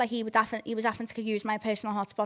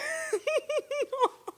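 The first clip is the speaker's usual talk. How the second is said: squealing high laugh; 6 dB quieter than usual. Real, not acted. Laughing at space excuse.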